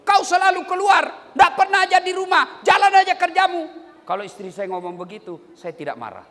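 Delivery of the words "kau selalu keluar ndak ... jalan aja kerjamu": loud, higher scolding; angry nagging wife. Pretend. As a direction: scold him at full volume. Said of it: "binimu marah seperti itu". (istri memarahi suami yang selalu keluar, berteriak)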